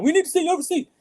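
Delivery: mock-prissy receipt check; mocking the clerk. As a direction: parrot the snippy clerk's demand. Mocking the store lady's demand.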